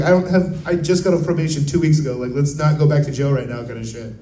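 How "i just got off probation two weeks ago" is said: ordinary talk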